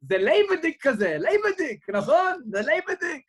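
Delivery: bursting up high and loud with delight; lively rabbi. Retold or imitated. Rabbi tells the boy he knows the pub, excited.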